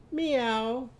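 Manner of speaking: high drawn-out meow; cat's meow. Shown as a demonstration. Teacher answers as the cat, high stretched meow. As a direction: mew it high, gliding down. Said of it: "What would our cat say?".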